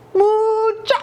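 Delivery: high loud call to far-off friends; lost dog calling for his friends. A narrator's reading. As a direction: shout across the planet for your pals. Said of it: "he called out".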